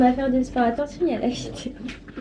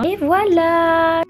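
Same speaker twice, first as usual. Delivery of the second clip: big reveal; 8 semitones higher, 6 dB louder, much slower than usual. Real, not acted. Outfit reveal to camera.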